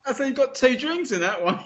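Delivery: fast teasing aside; quick wry quip. Retold or imitated. Fan mocking Tom Cruise's overpour, fast wry quip. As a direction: rattle off a dry joke. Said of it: "and you're like".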